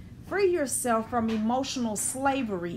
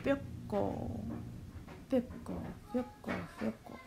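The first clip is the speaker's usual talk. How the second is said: hushed repetition; 2 semitones lower, 11 dB quieter, slower than usual. Shown as a demonstration. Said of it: "They're fickle".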